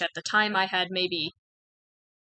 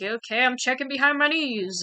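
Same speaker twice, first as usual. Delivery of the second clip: higher-pitched reluctant playing along; humouring an odd instruction; awkward compliance; 6 semitones higher, 3 dB louder than usual. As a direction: announce it in a raised, self-conscious voice. Acting out compliance with odd request.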